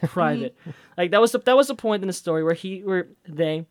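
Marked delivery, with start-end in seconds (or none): 0.0-0.6 lightly voiced teenager, caught out and laughing; a hint of a mortified teen; embarrassed teenager. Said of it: "like a teenager's like".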